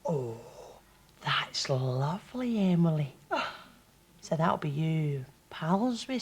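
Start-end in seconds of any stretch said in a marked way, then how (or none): none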